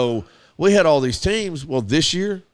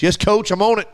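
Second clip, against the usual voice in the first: put-on a touch higher and louder, eager boy; eager kid; 6 semitones higher, 4 dB louder than usual. Young player eagerly answering his coach. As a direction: chirp it like a keen little player.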